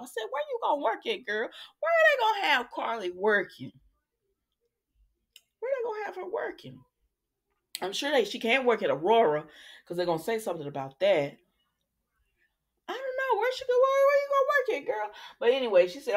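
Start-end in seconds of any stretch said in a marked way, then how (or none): 0.3-6.8 mock question. Mock asking character where she'll work. 12.9-15.1 much higher, teasing repeat; high mock question.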